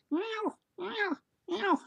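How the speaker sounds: cat meowing. Retold or imitated. Storyteller showing how a cat will do that, high soft meows. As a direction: imitate a cat with high little mews. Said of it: "how a cat will do that".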